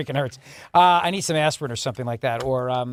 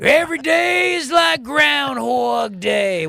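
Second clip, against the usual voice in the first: slow, loud, drawn-out rock-singer shout; 8 semitones higher, 3 dB louder, much slower than usual. Acted. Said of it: "don't forget to do [name]".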